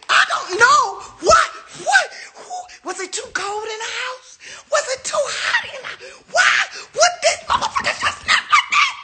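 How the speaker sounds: high, shouted half-crying rant; screeching rant. Real, not acted. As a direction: wail in disbelief, voice cracking. Ranting over broken glasses.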